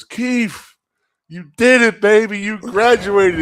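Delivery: high, loud whooping congratulations; ecstatic coach yelling. Retold or imitated. Strength coach runs at the player, shouting with joy. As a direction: scream it like the proudest coach alive. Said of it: "and he goes".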